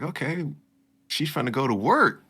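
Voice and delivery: surprised. Retold or imitated. Retelling own surprised realization.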